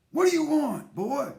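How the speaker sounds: angry father. Acted. Father snaps at scared son.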